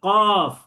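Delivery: loud drawn-out কফ for the class to repeat; সবাইকে শেখাতে টেনে কফ; model qaf. Shown as a demonstration. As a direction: stretch কফ long and heavy from the tongue root. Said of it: "শেষে ফা উচ্চারিত হবে".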